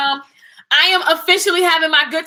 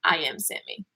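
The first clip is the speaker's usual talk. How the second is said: soft, lower, falling to a whisper; haughty dismissal; 7 semitones lower, 8 dB quieter than usual. Retold or imitated. Telling a doubter it's over their head, softly haughty. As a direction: brush them off, sweet and superior. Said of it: "and I'm like".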